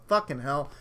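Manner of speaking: ordinary delivery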